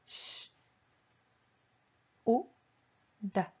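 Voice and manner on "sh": a soft hushed "sh" held on its own; isolated sh sound demo; sh sound alone. Shown as a demonstration. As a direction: breathe out a long quiet "sh". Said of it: "pueden hacer el sonido de la S H".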